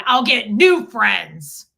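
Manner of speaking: put-on husband rejecting old friends, slow and defiant. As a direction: lay down the verdict slowly and hard. Fred's defiant reply.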